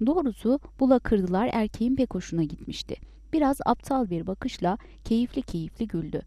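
plain reading voice

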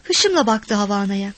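plain reading voice

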